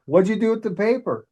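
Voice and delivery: confused patient wants the paper back; surprised question. Retold or imitated.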